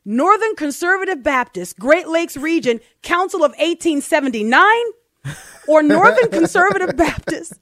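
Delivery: second man voiced eager and loud; eager stranger. A narrator's reading. Voicing second man, laughing.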